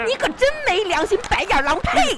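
mock indignant. Wife berating husband for wishing grandma dead. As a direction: scold him, end with a spit.

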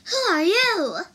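high, loud challenge; demanding. A narrator's reading. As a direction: demand it sharply with a swooping pitch. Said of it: "demanded the woman".

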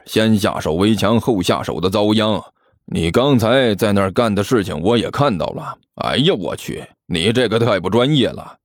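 low, scornful rogue monk; disdainful. Acted. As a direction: look down on an amateur in a low voice. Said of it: "不屑地看着齐健说道".

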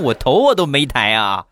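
usual speaking voice

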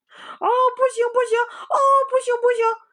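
very high, loud crying protest; shrieking pig. Acted. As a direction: scream and wail no no no. Pigsy pinned to bed. (猪八戒被按住时哭喊不行)

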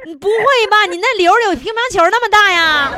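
high-pitched amused disbelief; incredulous. Real, not acted. Host to guest about a ping-pong-sized tumour, incredulous.

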